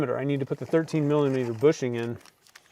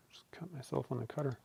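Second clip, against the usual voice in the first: whispering; 18 dB quieter, faster than usual. Real, not acted. Just cut himself, whispering.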